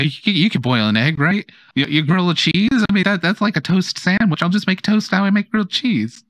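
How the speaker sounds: high, flustered aunt voice; squealing baby talk aunt. Acted. Aunt reasoning aloud, voiced high.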